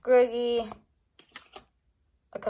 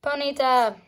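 normal talking voice

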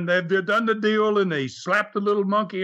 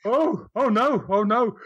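aghast moms; 7 semitones higher than usual. Retold or imitated.

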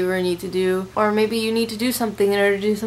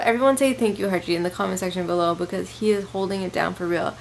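normal talking voice

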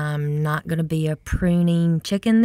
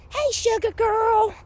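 much higher cooing pet voice; 14 semitones higher, 3 dB louder than usual. Real, not acted. Owner greeting her cat in a high cooing voice. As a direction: sing it up high to your cat.

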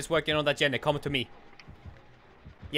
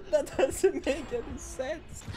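laughing; 9 semitones higher, 3 dB quieter, much slower than usual. Real, not acted. Laughing at killer.

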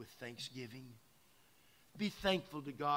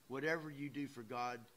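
normal talking voice

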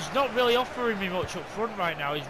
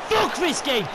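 high, loud raging shout; furious shout; 8 semitones higher, 8 dB louder than usual. Real, not acted. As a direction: spit it out in fury. Raging after late equaliser.

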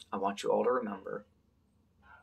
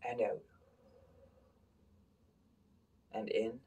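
guided breathing; 10 dB quieter than usual. Shown as a demonstration. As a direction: breathe with them, easy and quiet. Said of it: "guides kids through breathing".

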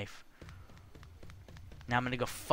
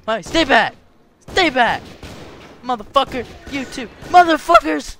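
high, loud warning yells, building; threatening shouts; 11 semitones higher, 13 dB louder than usual. Real, not acted. Player warding off game opponents, threatening shouts. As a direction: yell to keep attackers away.

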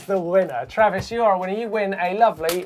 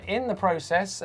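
ordinary talk

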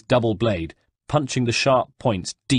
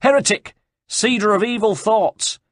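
much higher, loud denunciation; yelled accusation; 12 semitones higher, 4 dB louder than usual. Acted. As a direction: hurl the word at him. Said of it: "and yelled".

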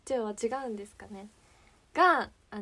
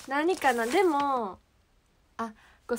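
ordinary delivery

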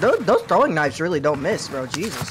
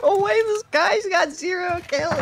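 high-pitched, cracking disbelief; can't believe what he's seeing; stunned disbelief; 14 semitones higher than usual. Real, not acted. Stunned at an enemy's stats.

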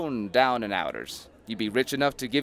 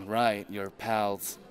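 ordinary talk, nothing marked

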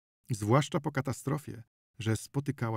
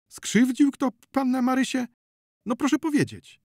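very high, loud, anxious questioning; high pleading concern; worried coaxing; 7 dB louder than usual. A narrator's reading. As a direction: press her to tell, voice pitched right up. Lad coaxes the crying girl.

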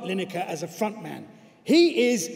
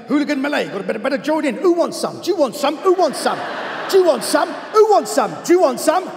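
high, loud cockney yelling, building; cockney hooligan shout; 7 semitones higher, 6 dB louder than usual. Acted. Football fan joining a hooligan brawl, shouting in escalating cockney. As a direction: spoil for a fight in raucous cockney. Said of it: "the more cockney we get".

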